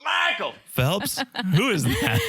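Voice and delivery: high, drawn-out teasing name call; mock heckling. Friends heckling him in the pool, high mock jeering. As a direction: imitate hecklers with exaggerated high-pitched questions. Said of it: "all of his friends were heckling him".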